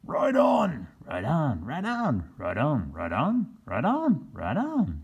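varied voices for each man; each man chimes in; chorus of men. Acted. Ten men agreeing with the captain, each in a different voice. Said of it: "said the ten best men".

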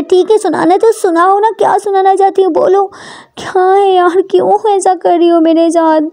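high-pitched, exasperated pleading; challenging Ishani. Acted. Ishani asks why Prita treats her so, exasperated. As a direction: dare her to speak, then plead.

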